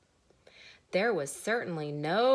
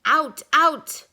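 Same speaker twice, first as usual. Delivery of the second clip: angry shout; 9 semitones higher, 14 dB louder than usual. Acted. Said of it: "cried Mister Duck".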